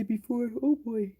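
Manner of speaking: talking through a yawn; words stretched by a yawn. Real, not acted. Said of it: "Not to yawn".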